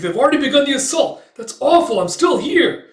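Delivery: very high, loud cry of alarm; panicked exclamation. Retold or imitated. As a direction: shout in dismay at sudden disaster. Exclaiming as the assault begins.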